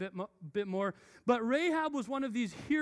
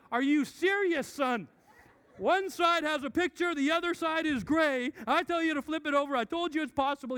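higher, louder frustrated scolding; exasperated dad; 7 semitones higher, 5 dB louder than usual. Retold or imitated. Dad scolding son over the puzzle, exasperated and loud. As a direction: lose patience with a stubborn kid.

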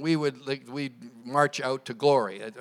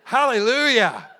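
drawn-out, raised exclamation; delighted praise; 10 semitones higher, 12 dB louder, slower than usual. Real, not acted. Pastor praising the congregation's response, delighted. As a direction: cheer the room on.

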